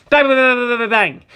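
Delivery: loud voiced bangs; gunshots. Narrator voicing Mister's six shots. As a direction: punch each bang.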